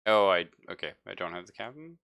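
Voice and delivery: ordinary delivery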